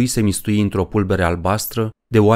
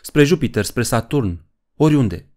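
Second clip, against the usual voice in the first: plain reading voice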